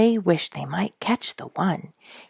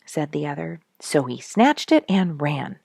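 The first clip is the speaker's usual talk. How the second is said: ordinary delivery, nothing marked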